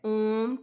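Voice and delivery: ओम stretched long and slow, three beats; drawn-out Om. Shown as a demonstration. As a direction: hold the vowel of ओम for three counts. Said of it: "time लग रहा है ना इसमें बोलने में".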